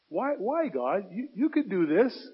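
high, pleading why; anguished cry to God. Acted. Pastor voicing his own plea to God, high and strained. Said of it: "I have the same conversations".

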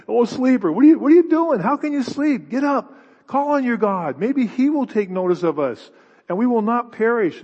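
frantic captain shaking Jonah awake; urgent ship captain. Acted. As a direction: rouse him with urgent alarm. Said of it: "the captain says to him".

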